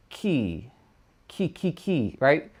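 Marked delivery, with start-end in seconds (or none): 1.3-2.2 soft repeated ki from the back of the tongue; back-tongue drill; ki syllables. Shown as a demonstration. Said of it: "we're gonna say".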